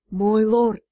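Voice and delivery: far-off voice over a magic link; distant distorted voice. A narrator's reading. Messenger calling the lord over a distant link, distorted. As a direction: sound like it comes through a crackling link. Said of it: "искаженное и далекое".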